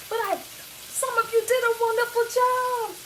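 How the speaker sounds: high, loud delight; proud of her viewers; beaming compliment; excited praise. Real, not acted.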